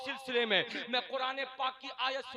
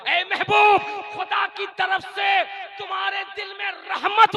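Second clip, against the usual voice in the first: loud, high, impassioned exhortation; full-force preaching; 6 semitones higher, 15 dB louder than usual. Real, not acted. Preacher explains God's mercy from the verse, forceful preaching. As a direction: cry the translation out to the crowd.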